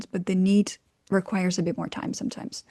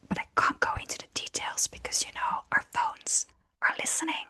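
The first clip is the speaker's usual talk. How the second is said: conspiratorial whisper; 8 dB quieter than usual. Pretend. Dodging a relative's question, joking conspiratorial whisper. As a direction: drop to a secret whisper. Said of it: "you could say".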